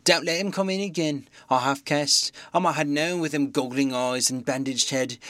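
put-on a distraught old landlady. Mrs Hall's frightened rant.

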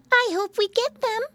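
put-on very high child voice, hoping; wishful piglet. A narrator's reading. Piglet hoping for Christmas toys, high wishful voice. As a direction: wish for it in a little piggy squeak. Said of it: "went on Flop Ear with a sigh".

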